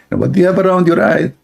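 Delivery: wife quickly quizzing husband. Acted. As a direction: rattle off the question. Wife voiced asking fast.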